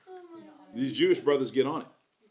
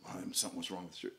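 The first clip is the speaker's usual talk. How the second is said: much softer, lower grumbling aside; a hushed, dropped-low gripe about the church; mock grumbling; 11 semitones lower, 19 dB quieter, faster than usual. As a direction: mutter it like a doubter. Voicing a complaining member.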